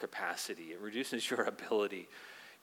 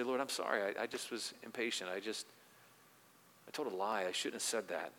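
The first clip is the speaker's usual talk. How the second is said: hushed, softer sorry-Lord prayer; quiet confession; 2 semitones lower, 7 dB quieter than usual. Acted. Believer confessing a lie to the Lord, hushed and softer. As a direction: murmur a humble apology to God.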